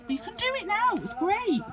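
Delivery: high, excited squeak; thrilled exclamation. Real, not acted. Crafter delighted to revive childhood folding, thrilled exclamation.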